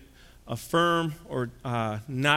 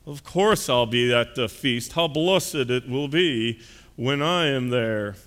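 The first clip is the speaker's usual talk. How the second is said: slow, drawn-out pompous drawl; smug well-to-do man sure of his invitation; presuming rich guest; 2 semitones higher, 3 dB louder, slower than usual. Acted. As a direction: stretch the words with haughty self-assurance. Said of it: "this man kind of was presuming".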